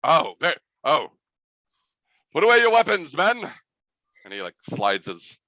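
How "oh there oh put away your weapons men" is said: high, loud order to stand down; gallant mouse commander. Acted. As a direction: wave the troops off grandly. Mouse leader calls off troops.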